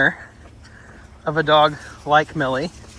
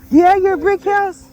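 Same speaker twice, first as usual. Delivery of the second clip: high, louder gushing voice; playful dog-talk; 5 dB louder than usual. Real, not acted. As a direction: gush playfully at a big dog. Teasing talk to big dog.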